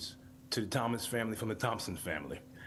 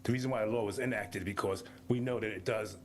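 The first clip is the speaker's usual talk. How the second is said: ordinary talk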